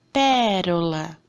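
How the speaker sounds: pérola drawn out slowly, first syllable pé stressed. Shown as a demonstration. Teacher to class, demonstrating a proparoxítona slowly. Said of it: "É o pé".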